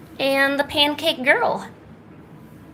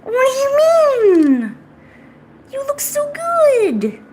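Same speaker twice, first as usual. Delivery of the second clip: very high, drawn-out squeal; loud, slow, squeaky cooing; 6 dB louder, much slower than usual. Real, not acted. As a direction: gush at your pet, stretching the words. Gushing at pet in baby talk.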